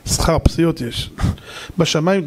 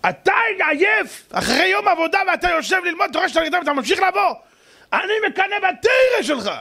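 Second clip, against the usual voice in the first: much higher, impassioned plea; passionate rabbi; 13 semitones higher than usual. Retold or imitated. The rabbi tells the tired driver he envies him, fervent. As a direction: lift it like fervent praise.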